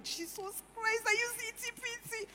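high, squealing delight over a new translation; excited squeal. Retold or imitated. Wife gushing to her husband about a Bible app, squealing excitedly. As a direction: gush like you found treasure. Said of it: "I'm like".

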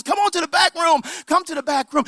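high, imitated auntie call; calling hostess. Auntie calling the group along.

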